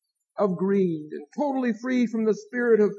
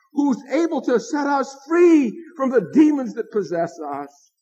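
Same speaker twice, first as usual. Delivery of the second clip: preaching at full force; 3 semitones higher than usual. Acted. Pastor proclaims Jesus' power over demons to the congregation, preaching at full force. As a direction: lift the congregation with it.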